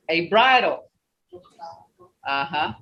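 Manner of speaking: normal talking voice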